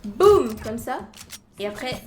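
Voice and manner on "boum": loud, punched explosion word; booming sound effect. Pretend. Streamer acting out a game play, loud boom. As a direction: boom it out, much louder.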